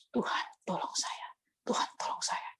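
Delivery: whispered plea for help; desperate whisper. Retold or imitated. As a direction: breathe the prayer out in fear. Frightened prayer before demonic presence.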